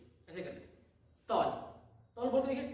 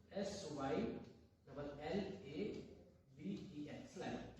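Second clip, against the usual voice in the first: spelling out; 9 semitones lower, 12 dB quieter, much slower than usual. Shown as a demonstration.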